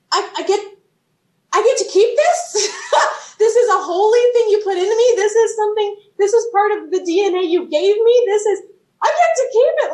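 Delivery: laughing delight. Retold or imitated. Her amazed reply to God, high and laughing. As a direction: giggle through it, thrilled she can keep it.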